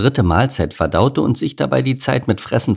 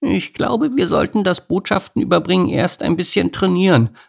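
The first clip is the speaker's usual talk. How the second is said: earnest; 11 semitones higher than usual. Acted. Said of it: "sagte Henriette ernst".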